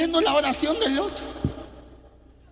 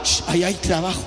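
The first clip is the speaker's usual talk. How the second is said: hushed voice of God; 9 semitones lower, faster than usual. Retold or imitated. God answering in story.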